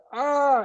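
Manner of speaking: high, sudden gasp of recognition; surprised gasp. Retold or imitated. Fan recognising the clip, gasping high in surprise. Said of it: "I was like".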